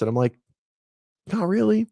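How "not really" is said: high-pitched, reluctant protest; reluctant reply. Retold or imitated. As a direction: answer much higher, as if dreading the idea. Retold reluctant answer to excited question.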